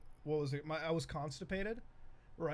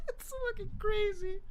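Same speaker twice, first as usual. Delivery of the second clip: words squeezed out high through laughter; laughing. Real, not acted. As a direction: say it while still cracking up. Laughing at dad story.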